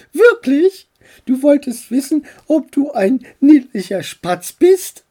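high, loud, amused questioning; playful high-voiced disbelief; teasing aunt. A narrator's reading. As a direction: tease in a high-pitched aunt voice. Amused aunt teases the boy.

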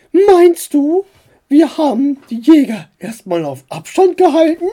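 high, loud aunt voice; high-voiced, emphatic question. A narrator's reading. Aunt asks, voiced by narrator.

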